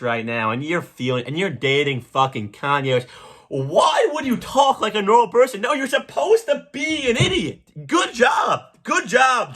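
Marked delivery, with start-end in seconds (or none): 3.5-9.5 mock outrage. Comic sarcastically defending Julia Fox's voice, high mock shout. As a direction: bellow it like it's obvious.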